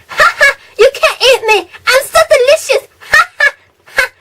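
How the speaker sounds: high squeaky gloating candy, cackling as it teases; be the smug chocolate laughing at the one who can't eat it; taunting chocolate voice. Pretend. Chocolate bar mocking the hungry vlogger, high squeaky cackle. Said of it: "It's like".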